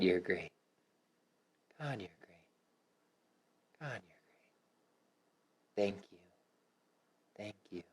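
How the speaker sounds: tender self-affirmations fading to nothing; soft affirmations fading to whisper. Shown as a demonstration. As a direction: murmur it to your own heart. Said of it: "One of my favorites".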